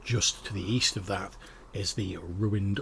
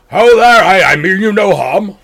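high, full shout across the camp; bellowing dwarf; 10 semitones higher, 18 dB louder than usual. Acted. Dwarf hailing the campers from his cart, bellowing. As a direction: bellow a friendly hail. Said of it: "And he's like".

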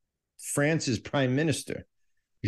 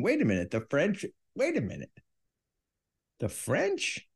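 rising to a much higher, incredulous "The French?"; mock surprise; 7 semitones higher, 4 dB quieter than usual. Host feigning shock that the French started total war, with mock surprise. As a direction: feign shock, climbing higher each time.

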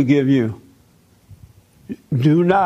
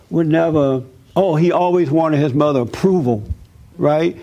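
ordinary talk

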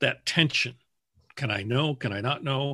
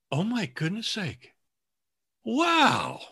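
much higher, breathless mock astonishment; imitating stunned surprise; 9 semitones higher, slower than usual. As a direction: play the author suddenly realizing. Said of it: "The wash of surprise across".

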